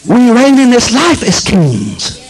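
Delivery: high shouted scripture, slowed on power; preaching at full force. Acted. Preacher crying scripture to the congregation, full shout. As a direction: thunder the promise out.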